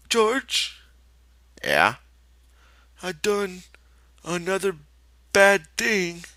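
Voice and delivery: put-on high small childlike voice; childlike confession. Lenny confesses to George, childlike.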